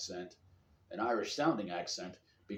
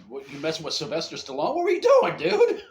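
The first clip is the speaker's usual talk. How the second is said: loud, rising to a high squawk; mock incredulous; 6 semitones higher, 13 dB louder than usual. Friend grilling the Rocky sound-alike, hammed-up disbelief. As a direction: build to an outraged squeal. Acted challenge to the sick man.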